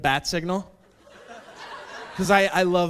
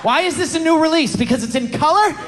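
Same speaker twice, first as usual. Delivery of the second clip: furious rant; 3 semitones higher, 6 dB louder than usual. Acted. Ranting at Blockbuster.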